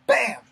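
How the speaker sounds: loud sharp bam for the punch; imitated punch. Telling how the stranger punched him, loud bam. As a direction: slam out the hit sound. Punched mid-sentence in the car.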